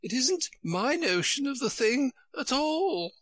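very high-pitched storybook queen; high Queen correcting Alice; fussy Queen. A narrator's reading. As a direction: put on a high, querulous royal voice.

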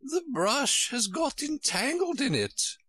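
high queen voice with a mild sigh; high fussy queen, lightly sighing. Acted. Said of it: "the Queen said with a sigh".

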